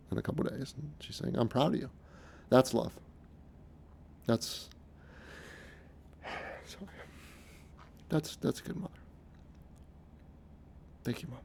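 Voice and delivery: hushed and halting, close to tears; choked up. Real, not acted. Son thanking his mother for her love, choked up. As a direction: get overcome, voice dropping almost to nothing between long pauses. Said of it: "getting a little emotional here".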